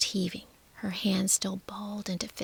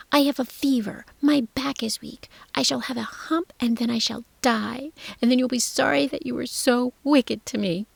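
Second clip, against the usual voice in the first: tantrum; 3 semitones higher, 6 dB louder than usual. Acted. Colin's tantrum continues.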